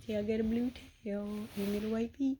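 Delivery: ordinary talk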